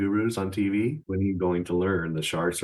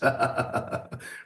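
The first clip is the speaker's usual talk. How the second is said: breathy high laugh; 6 semitones higher than usual. Real, not acted. Mocking laugh.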